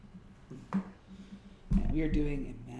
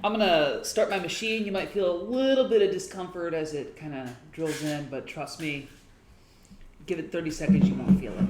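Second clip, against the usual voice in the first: mock interrogator; 4 semitones higher, 9 dB louder than usual. Interrogator starts torture machine.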